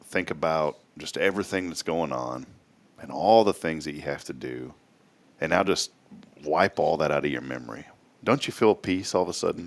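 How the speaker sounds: low, soft, calming voice; guided meditation. Pretend. Host guiding co-host to clear his mind, soft meditation voice. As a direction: ease the listener into peace with gentle pauses. Leads co-host through relaxing exercise.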